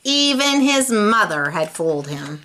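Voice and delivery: plain reading voice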